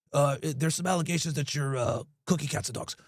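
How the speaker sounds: low, soft, hesitant mock-serious voice; fake serious caller. Prank caller to shop owner, mock serious and low. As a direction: keep a straight face while delivering the accusation. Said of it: "trying to stay all serious".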